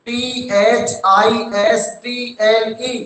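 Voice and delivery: each letter drawn out, very slow; slow letter-by-letter spelling. Shown as a demonstration. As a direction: spell it out so the class can write. Teacher spelling slowly for dictation. (हर अक्षर धीरे-धीरे बोलते हुए)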